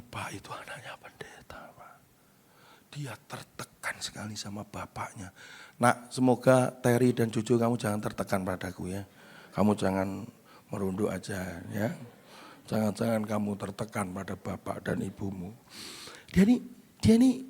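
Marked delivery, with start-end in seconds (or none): 0.0-5.3 very low, hushed aside; confidential whisper. Acted. Teacher confides to pastor about a troubled child, whispering. 5.8-15.5 low, soft, tender reassurance; gentle fatherly plea. Acted. Father hopes his children never feel pressured, gently pleading.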